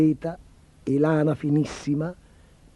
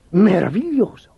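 louder burst of wonder; delighted exclamation; 3 semitones higher, 5 dB louder than usual. A narrator's reading. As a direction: exclaim it with a big pitch swoop. Emperor delighted by the promise.

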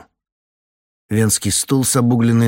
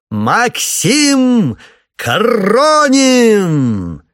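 high, slow, loud showman's announcing call; booming circus ringmaster; 14 semitones higher, 9 dB louder, much slower than usual. A narrator's reading. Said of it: "Надрывается шпрехшталмейстер".

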